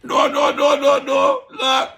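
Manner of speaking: high, loud, excited no-no-no; laughing protest. Real, not acted. Host to listeners, cutting off his own joke, loud and high.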